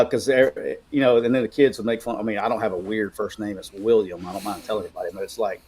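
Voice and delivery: ordinary delivery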